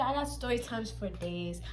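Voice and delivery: slow, soft aside; quiet, unhurried claim; understated boast. Real, not acted. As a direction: say it low-key and drawn out. First of escalating repeated boast.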